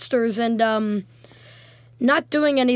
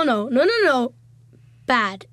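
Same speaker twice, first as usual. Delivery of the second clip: normal talking voice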